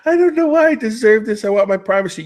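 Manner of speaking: mocking whiny girl. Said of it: "and you go".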